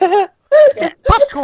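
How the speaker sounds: high-pitched gleeful shriek; excited squeal. Pretend. Joining the popcorn joke.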